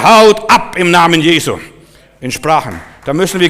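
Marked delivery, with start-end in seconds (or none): 0.0-1.6 loud, raised-pitch order to spirits; forceful command. Shown as a demonstration. Preacher showing how to speak to evil spirits, loud and commanding. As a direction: drive them out with a booming command. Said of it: "da darfst du nicht zimperlich sein".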